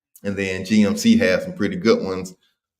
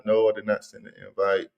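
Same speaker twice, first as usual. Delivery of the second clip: ordinary talk, nothing marked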